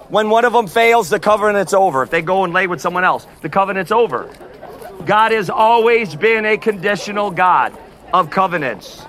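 ordinary delivery

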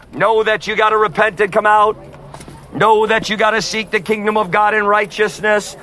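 usual speaking voice

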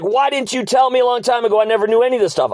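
righteous anger. Retold or imitated. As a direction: imitate an angry wife raising her pitch, not shouting. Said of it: "raising her voice".

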